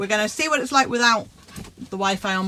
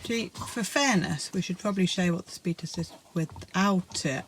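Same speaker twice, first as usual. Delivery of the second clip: ordinary talk, nothing marked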